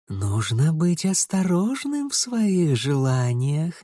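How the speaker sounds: slow, seductive whispering spirit; whispering lust. Acted. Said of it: "шептала похоть".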